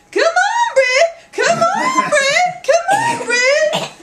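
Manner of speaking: very high, loud laughing call; boy dragging a loaf home on a string, calling it; calling the bread. Acted. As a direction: cheerfully coax the bread along.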